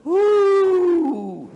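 loud, very high, sustained hoot; high "wooo" held out; owl hoot. Acted. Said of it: "he just hooted like an owl".

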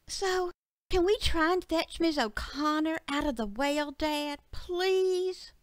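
put-on high, pleading child voice; little girl Betsy. Betsy begs her dad to rescue Miss O'Connor, high and pleading. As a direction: beg like a young daughter.

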